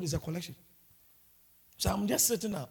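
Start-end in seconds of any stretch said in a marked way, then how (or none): none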